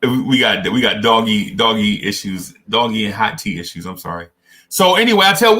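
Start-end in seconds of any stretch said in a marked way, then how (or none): none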